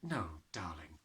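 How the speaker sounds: soft, low reassurance; gentle. A narrator's reading. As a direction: soothe the child gently. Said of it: "Miss Honey said".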